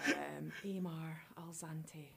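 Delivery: slow whisper to oneself; hushed, drawn-out repetition; pensive whisper. Real, not acted. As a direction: whisper the name while puzzling it out. Thinking over the name to herself.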